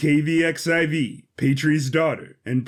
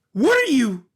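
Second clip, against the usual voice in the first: startled; 10 semitones higher, 3 dB louder than usual. Acted. Boy startled by robot's move.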